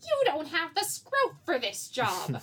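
slow, high, intense cartoon delivery; Scrappy. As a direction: drag it out with mock intensity. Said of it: "one of Scrappy's m-, intense lines".

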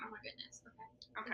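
breathy whispered reply. Real, not acted. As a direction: whisper back in suspense. Whispering back in excitement.

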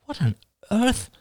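high baffled exclaim. A narrator's reading. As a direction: sound utterly baffled. Baffled brother-in-law.

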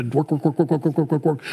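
rapid-fire, evenly chanted string of words; mock frantic churning; frantic repetition. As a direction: rattle it off like nonstop toil. Mimics frantic striving for salvation.

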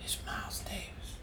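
rasped, breathy near-whisper. Retold or imitated. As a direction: imitate a raspy voice on a late-night phone call. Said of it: "I hear this raspy voice".

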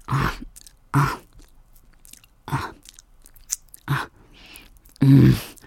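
usual speaking voice

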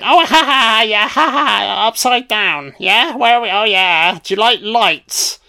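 high, put-on gloating captor; mock taunting laughter.

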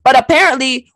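ordinary talk, nothing marked

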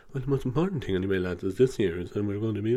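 mock manager fading out, muffled and far away; faint muffled audio. Imitating a manager cutting out on a call. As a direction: voice drops away like a bad Zoom line. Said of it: "couldn't hear them very well".

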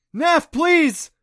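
high, loud desperate plea; shouting for help with his grip slipping; desperate begging. Acted. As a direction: beg at the top of your voice. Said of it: "Adam begged".

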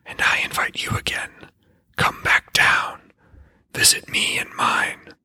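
hushed voiceless whisper of an unseen spirit; the well spirit taunting Odin in a whisper. A narrator's reading. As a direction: breathe it up from the well like a hidden spirit.

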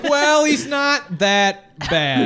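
loud, high, slow defense; drawn-out pleading defense; defending loudly. Pretend. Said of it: "And then I'm like".

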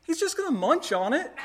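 lightly voicing a toddler reassuring dad; a hint of a small boy, matter-of-fact; child's voice. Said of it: "and he goes".

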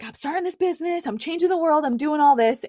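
high, bragging pitch voice, imitating her old self; mock hype. Telling listeners how she gassed up her new business, in a high mock-hype voice. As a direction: hype it up like a cocky new founder. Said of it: "I gassed it up to everyone".

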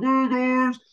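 greeting through a mouthful of pasta; mouth full. Retold or imitated. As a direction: talk round a bite of food. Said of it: "my mouth is all full of, like, pasta".

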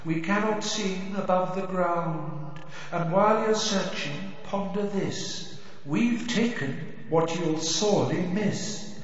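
slow, high eerie chant; drawn-out spectral murmur like a ghostly chorus; haunting voice. Acted. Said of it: "a chorus of eerie voices singing".

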